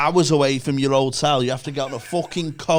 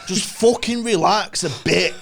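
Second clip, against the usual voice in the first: exasperated shout; 6 semitones higher than usual. Real, not acted. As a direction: shout it out, fed up with uptight people. Exasperated rant at nervous travellers.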